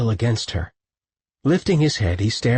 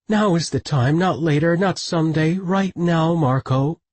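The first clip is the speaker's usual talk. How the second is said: woman's voice; 8 semitones higher than usual. A narrator's reading. Sobbing woman insists.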